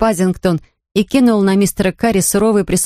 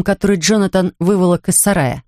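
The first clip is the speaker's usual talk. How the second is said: usual speaking voice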